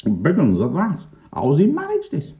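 high-pitched astonishment; baffled policeman. A narrator's reading. Pongo can't believe the oranges vanished, high and baffled. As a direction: puzzle over it, pitched well up.

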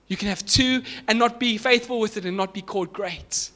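impassioned preaching. Real, not acted. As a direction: preach it hard to the whole room. Preaches at full force.

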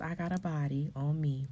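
ordinary delivery

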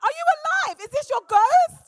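very high, loud disbelieving questions; comically exaggerated shock; retold astonishment. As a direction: shout it like you're talking to a ghost. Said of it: "So I was like".

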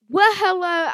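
high, loud, bright-eyed channel greeting; YouTube intro greeting. Acted. Host opening a YouTube video, loud upbeat greeting. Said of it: "I'm gonna do my intro for YouTube".

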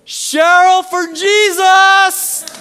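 very high, loud, stretched yell; imitated yell. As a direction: holler it from the field like a fan. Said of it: "he would start yelling".

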